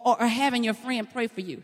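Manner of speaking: normal talking voice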